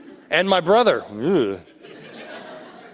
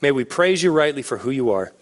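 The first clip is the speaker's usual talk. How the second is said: prayer; 6 semitones lower than usual. Acted. Pastor closing the sermon in prayer, hushed and lower.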